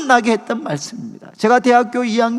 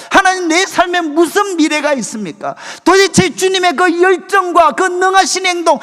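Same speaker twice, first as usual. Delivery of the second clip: anguished protest; 6 semitones higher, 4 dB louder than usual. Retold or imitated. Retelling his own desperate prayer.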